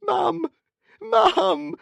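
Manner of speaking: very high wailed cry for mother; begging. A narrator's reading. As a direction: wail for help, desperate. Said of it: "he begged".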